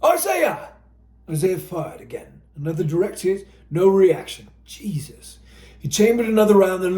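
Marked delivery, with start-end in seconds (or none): 0.0-0.7 high calling out; called name. Acted. Renoir calling to Isaiah, high call. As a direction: call across the field. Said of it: "Renoir called out".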